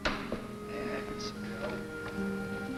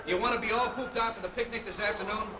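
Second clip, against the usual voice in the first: higher, kindly coaxing voice; gentle coaxing; 7 semitones higher than usual. Acted. Coaxing kids out of pool.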